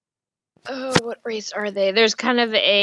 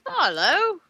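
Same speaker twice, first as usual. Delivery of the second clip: orc shopkeeper greeting; 5 semitones higher than usual. Acted.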